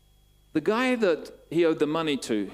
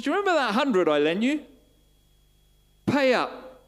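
high, louder acted confrontation; demanding; 11 semitones higher, 5 dB louder than usual. Forgiven man accosts someone who owes him a hundred pounds, demanding. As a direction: play the creditor pressing hard for repayment.

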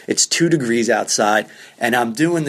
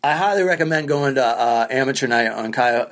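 ordinary delivery

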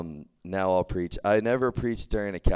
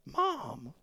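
much higher, groaning "Mom"; mortified sons; 9 semitones higher, 5 dB quieter than usual. Retold or imitated. Sons embarrassed by their mother, higher groan. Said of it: "the guys are like".

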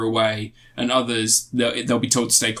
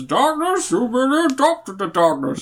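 mock villain line, pitched way up and put-on; movie villain voice; 7 semitones higher than usual. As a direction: ham up a dramatic movie villain speech.

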